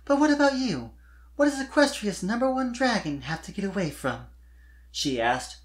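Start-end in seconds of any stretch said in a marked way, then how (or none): 0.0-4.3 put-on high, airy young filly voice, lifted well above the narrator; a gushing young pop star filly; Sweetie's voice. Sweetie complaining to Spike about fans, high filly voice.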